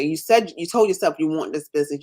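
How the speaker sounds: ordinary delivery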